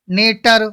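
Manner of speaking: "नेटर" loud, with T instead of the ch sound; wrong pronunciation. Shown as a demonstration. Teacher warning students how not to read nature, loud hard-T misreading. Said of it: "अब ऐसा नहीं पढ़ेंगे".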